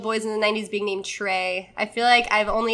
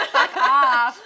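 laughing; 2 semitones higher, 7 dB louder than usual. Real, not acted. Laughing dismissal of complaints.

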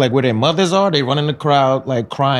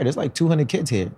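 normal talking voice

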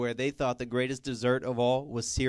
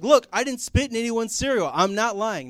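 insisting innocence; 8 semitones higher, 6 dB louder than usual. Retold or imitated. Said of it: "And I'm like".

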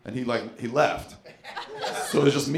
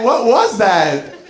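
higher, louder and slow; friend baffled; 8 semitones higher, 6 dB louder, much slower than usual. Retold or imitated. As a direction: vraag het stomverbaasd, hoger en luider. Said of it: "She's like".

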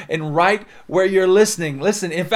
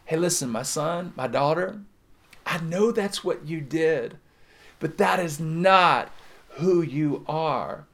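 tender God; slower than usual. Acted. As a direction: gently lift a child back up. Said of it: "God comes along and he says".

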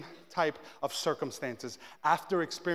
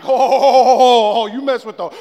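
very loud, higher run of ohs; hyped-up challenge; 5 semitones higher, 18 dB louder than usual. Retold or imitated. Husband ready to war with driver, loud retold bluster. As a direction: blurt it out, roaring for a fight.

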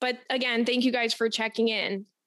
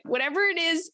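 usual speaking voice

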